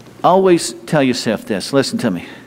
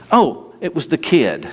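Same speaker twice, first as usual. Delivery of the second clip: ordinary delivery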